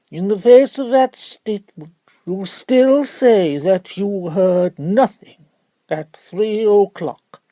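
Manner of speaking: high, slow, loud pressing question; pompous barrister. Acted. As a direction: bear down on the witness.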